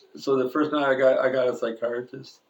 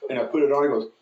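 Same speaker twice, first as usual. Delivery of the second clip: ordinary delivery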